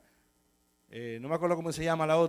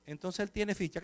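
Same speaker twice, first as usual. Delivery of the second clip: softer, slow and hesitant; timidly; 6 dB quieter, slower than usual. Retold or imitated. Pastor timidly asking the captain about the record, softer. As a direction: ask meekly, afraid to bother him. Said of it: "digo yo tímidamente".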